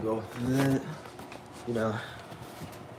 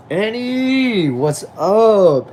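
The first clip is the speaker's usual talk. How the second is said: high, loud call to a new viewer; excited greeting; 8 semitones higher, 6 dB louder, much slower than usual. Real, not acted. Streamer hails a viewer joining the chat, excited. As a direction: sing out her name, thrilled she's here.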